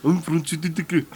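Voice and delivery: high, exaggerated South African accent. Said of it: "his South African accent was ridiculous".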